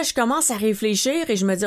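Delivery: ordinary talk, nothing marked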